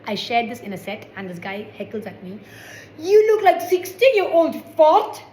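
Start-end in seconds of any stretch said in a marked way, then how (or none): 3.0-5.2 put-on loud, high, slow Scottish-accented heckle. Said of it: "said that in a Scottish accent".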